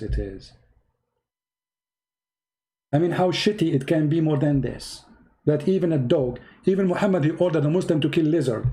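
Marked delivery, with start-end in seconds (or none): none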